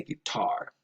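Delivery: guitar with TAR stressed; majority pronunciation. Shown as a demonstration. Telling learners what most speakers say, guitar stressed on TAR. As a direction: lean on the second syllable of guitar. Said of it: "the majority of speakers".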